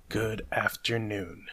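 cold, measured dismissal; dismissive. Acted. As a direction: draw it out softly to end the talk. Scrooge curtly dismisses his nephew.

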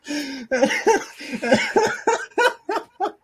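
high-pitched laughing fit; long, breathless cackle; hysterical laughter. Real, not acted. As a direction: laugh helplessly in high bursts. Cracking up at own reveal.

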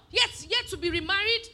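usual speaking voice

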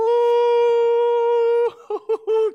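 high, loud burst of laughter; delighted laugh. Real, not acted. Preacher laughs with the church at the idea, real laugh.

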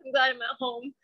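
tearful, voice thick with crying; talking through real tears; ugly crying. Real, not acted. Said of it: "I'm sorry for also ugly crying".

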